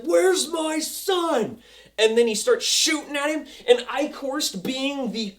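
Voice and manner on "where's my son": much higher, slow mock demand. Father confronting the villain, slow mock demand. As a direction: draw it out like a desperate father. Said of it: "And F's like".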